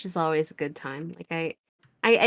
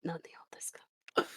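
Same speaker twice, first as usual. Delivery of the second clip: breathy whispered fake Japanese; whispering; 2 semitones lower, 14 dB quieter, faster than usual. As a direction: whisper the words back.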